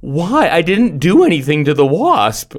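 drawn-out imitation of confused indignation; retold bewilderment. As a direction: protest like a baffled, wronged kid. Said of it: "He was just so confused".

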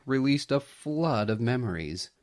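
ordinary delivery

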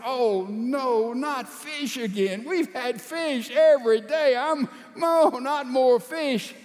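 high-pitched mock whine of protest; dismayed complaint. Peter complains to his wife about fish, dismayed complaint. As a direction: groan it out, dragging the last words.

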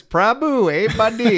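hammed-up Indian character accent; mock Indian accent. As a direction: call out in a put-on Indian accent. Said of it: "he loves the Indian people".

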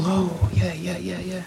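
high, soft, yielding yeses; eagerly agreeable. Retold or imitated. Agreeing to whatever the girl wants, soft. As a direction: give in sweetly and softly. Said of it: "The girl".

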